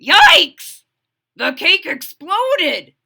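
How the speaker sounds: loud high shocked yell, slow; father shocked by the explosion. Acted. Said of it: "yelled Froggy's father".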